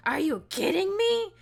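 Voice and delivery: high, loud shocked yell; retold yell. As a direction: yell it like an outraged teacher. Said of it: "And she yells".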